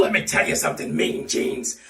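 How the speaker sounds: ordinary talk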